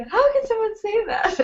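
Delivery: very high-pitched incredulous question; mock disbelief. Recalling first reaction to uncle's advice, incredulous. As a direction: retell baffled outrage in a high voice. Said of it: "I was like".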